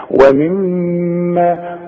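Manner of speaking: ومما very slow with a long humming mm; nasal ghunna. Shown as a demonstration. Teacher corrects the error, long nasal hum in ومما. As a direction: hold the m in ومما through the nose. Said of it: "ما دام عليها الشدة غنها".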